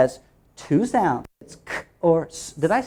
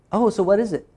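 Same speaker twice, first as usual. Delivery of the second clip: normal talking voice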